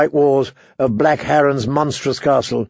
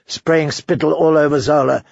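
plain reading voice

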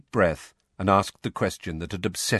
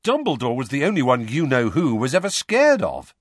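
raised, loud voice of disbelief; incredulous; 6 semitones higher, 6 dB louder than usual. A narrator's reading. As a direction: stress it emphatically, high and loud, as if astonished. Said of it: "said Bill incredulously".

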